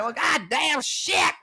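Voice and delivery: slow high dismayed yell; mock disappointed shout. Rejected by the girl, slow high mock dismay. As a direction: wail it, mock crushed.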